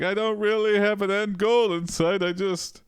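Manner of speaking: high-pitched creature voice confessing; a high, plaintive otherworldly being; ethereal Steve. Acted. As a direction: admit it helplessly in a high put-on voice. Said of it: "Steve's like".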